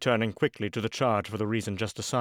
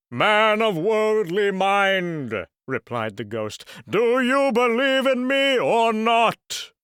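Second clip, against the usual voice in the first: slow, loud, otherworldly demand; solemn ghost; 8 semitones higher, 6 dB louder, slower than usual. Acted. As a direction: intone it like a doomed spirit.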